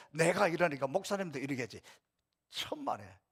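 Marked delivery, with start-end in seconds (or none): none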